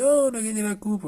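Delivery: lightly hammed-up high cheer; mock fan delight. Fans who would have won the cup, mock delight. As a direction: imitate pleased fans, voice lifting. Voicing the pleased supporters.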